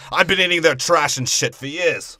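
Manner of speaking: lightly voiced grumbling Grinch; a hint of the Grinch complaining. Comic ranting as the Grinch, lightly voiced. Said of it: "what the fuck is up with the Grinch".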